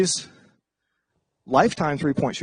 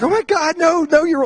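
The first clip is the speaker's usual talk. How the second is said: much higher, panicky reassurance; mock-frantic higher voice; flustered gushing; 11 semitones higher, 3 dB louder than usual. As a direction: scramble to cover for a friend. Flustered friend rescuing the intro.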